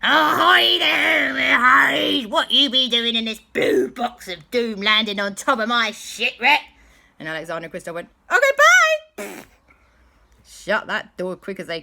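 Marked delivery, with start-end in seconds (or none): 0.0-6.6 loud, far-off gruff pirate bellow; pirate dog shout. Acted. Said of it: "most dastardly dog pirate". 8.3-9.5 very high, loud quick goodbye; panicked escape. Acted. Kids fleeing the pirate.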